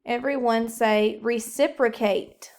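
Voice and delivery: normal talking voice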